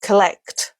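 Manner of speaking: 'Collect' with a weak, unstressed first vowel; schwa in first syllable. Shown as a demonstration. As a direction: reduce the start of 'Collect' to a quick 'kuh', stress the second syllable. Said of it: "a schwa".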